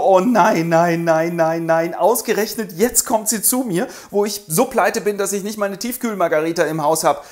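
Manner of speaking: high mock panic nein nein; panicking. Federigo panics.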